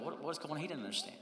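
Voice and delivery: usual speaking voice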